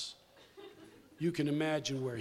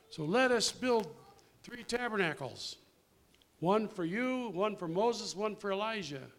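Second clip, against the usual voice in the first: mocking naive Peter; 6 semitones higher, 4 dB louder than usual. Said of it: "what did Mister Smart Guy say?".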